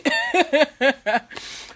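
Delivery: bright burst of laughter; real laugh. Real, not acted. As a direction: laugh out loud at yourself. Laughs at own sickness.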